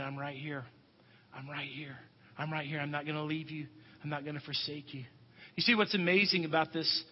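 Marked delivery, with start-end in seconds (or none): 0.0-5.0 low, soft reassurance; gentle Jesus. Acted. Jesus to the congregation this morning, hushed. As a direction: drop to a hushed, comforting promise. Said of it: "Jesus is saying this morning".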